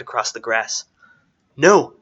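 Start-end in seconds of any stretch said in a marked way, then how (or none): 1.6-1.9 loud, high cry of refusal; desperate yell. A narrator's reading. Strider sees Arwen dragged across the grass, yelling. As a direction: yell out in alarm. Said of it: "yelled Strider".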